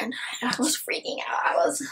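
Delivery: hushed, choked-up confession; whisper. Real, not acted. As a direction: whisper it as if overwhelmed. Reliving the emotional ending.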